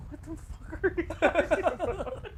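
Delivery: high, slow retold disbelief; incredulous. Retelling his reaction to her mistake.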